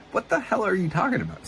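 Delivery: high-pitched incredulous protest; retold disbelief. Retold disbelief at coach's demand.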